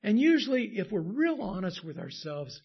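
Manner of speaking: usual speaking voice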